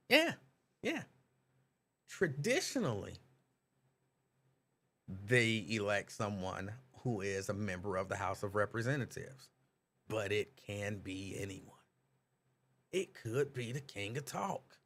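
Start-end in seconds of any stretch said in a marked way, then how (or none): none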